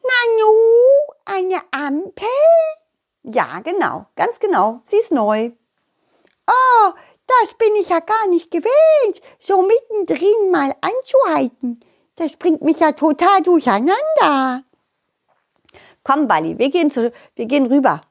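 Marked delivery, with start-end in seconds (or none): 0.0-2.3 high, slow puzzled snail voice; surprised snail. Acted. Snail surprised by traffic light. 6.5-14.6 high, flustered little snail voice. Acted. Snail complains.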